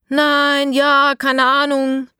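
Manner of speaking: loud, high, jumbled answers; children calling out. A narrator's reading. The kids shout over each other to Eda, loud and high. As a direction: blurt it out all at once, loud and high. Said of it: "rufen die Kinder durcheinander".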